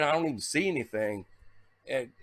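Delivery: usual speaking voice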